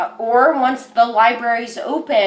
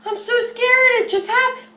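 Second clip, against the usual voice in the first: put-on high, small frightened child voice; a child running to an adult in fear; scared little child; 12 semitones higher than usual. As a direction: play a terrified little kid pleading for comfort. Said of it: "imagine a little child coming up to you terrified".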